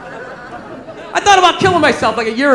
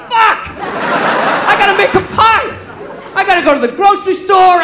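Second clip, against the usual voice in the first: high-pitched exasperated outburst; comic dismay; 6 semitones higher than usual. Retold or imitated. Boyfriend realizing he promised a pie, comic dismay. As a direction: wail about the chore in mock despair.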